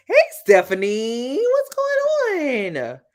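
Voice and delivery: high, slow, stretched hello; sing-song greeting. Real, not acted. Host greeting Stephanie in the chat, sing-song high. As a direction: sing out a welcome to a friend. Greeting viewer joining stream.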